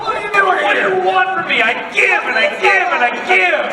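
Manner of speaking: high, loud, hammed-up shouting; mock exasperated rant. Actor asking fans what they want, shouted mock exasperation. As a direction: escalate into theatrical pretend exasperation.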